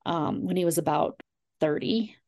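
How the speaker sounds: ordinary delivery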